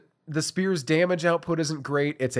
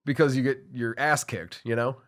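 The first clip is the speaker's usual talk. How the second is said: usual speaking voice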